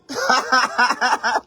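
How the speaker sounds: high-pitched rapid cackle; helpless high giggling; real burst of laughter. Real, not acted. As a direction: burst out laughing in a long peal.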